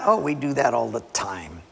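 ordinary talk, nothing marked

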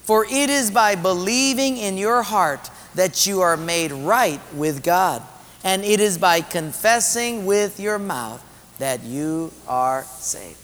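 scripture read aloud. Acted. Pastor leading the next scripture on salvation, deliberate reading aloud. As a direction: stretch the phrases so everyone reads along.

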